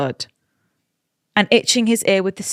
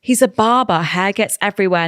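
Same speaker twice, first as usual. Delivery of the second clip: ordinary talk, nothing marked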